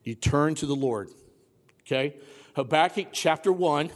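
ordinary talk

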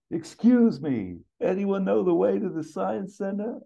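high-pitched character entrance; Axel Foley. Acted. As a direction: call out in a high put-on voice, stretching the opening. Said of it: "And Axel walks in".

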